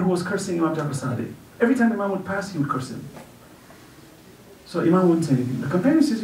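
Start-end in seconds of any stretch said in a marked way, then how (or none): none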